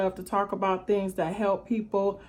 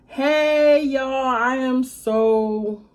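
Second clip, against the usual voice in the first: excited; 4 semitones higher, 7 dB louder, much slower than usual. Real, not acted. Excited greeting to viewers.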